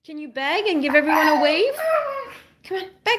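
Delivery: high, sing-song coaxing; coaxing pet talk. Real, not acted. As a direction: coax a dog into a trick, pitched high. Coaxing the dog to do a trick.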